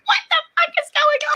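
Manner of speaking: high, loud, shrieking panic; shocked freak-out. Retold or imitated. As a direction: squeal it like he's right on top of you. Panicking at location getting closer.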